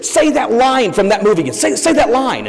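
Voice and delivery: high, eager pestering voice; excited fan. Retold or imitated. Fan stopping the actor on the street, excited and high. Excited fans accosting the star.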